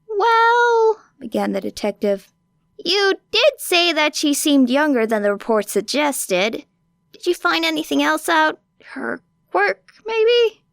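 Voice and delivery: a hint of the prying detective, curious; detective gently probing for details. A narrator's reading. Detective voiced higher.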